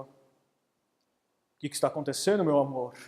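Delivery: low, slow, calm voice; calm husband. Acted. Husband calmly asking his wife what is happening. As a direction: ask it gently and low.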